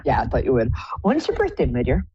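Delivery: ordinary talk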